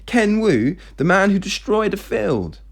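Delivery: high-pitched, scandalised disbelief; imagined incredulous reply. Acted. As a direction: repeat the name as if appalled.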